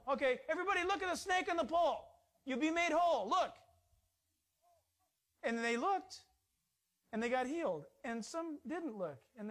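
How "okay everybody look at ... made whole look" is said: high, loud, fast call; calling out to the crowd. Retold or imitated. Moses calling the people to look at the snake, high loud call. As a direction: announce it to everyone, high and loud. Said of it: "So tell them".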